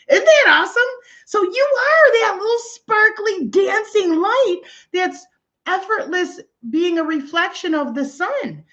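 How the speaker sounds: thrilled, selling the wonder of it; bursting with spiritual delight; excited gush. Real, not acted. Gushing over a joyful image.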